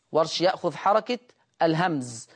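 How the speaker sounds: ordinary talk, nothing marked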